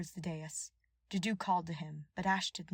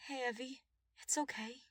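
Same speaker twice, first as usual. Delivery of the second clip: soothing; 7 semitones higher than usual. A narrator's reading. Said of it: "he soothed".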